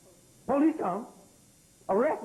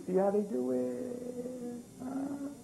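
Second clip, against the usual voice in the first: slow drawn question; 4 semitones higher, 5 dB quieter, much slower than usual. Real, not acted. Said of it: "slow knowing question".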